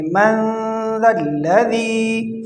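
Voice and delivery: slow من ذا الذی, long nasal n; nasal ghunna. Shown as a demonstration. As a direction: hold the ن of من as a hum. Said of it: "اس پر غنہ کریں گے". (من کے نون پر غنہ)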